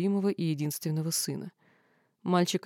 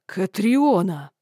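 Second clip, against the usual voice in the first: furious roar; 8 dB louder, much slower than usual. Acted. Third furious repetition.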